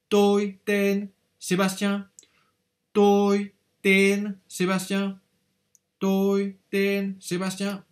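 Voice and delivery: Toi ten Sébastien slow and high, tones clear; slow model answer. Shown as a demonstration. Teacher repeats the answer my name is Sébastien. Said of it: "regardez bien comment on la forme".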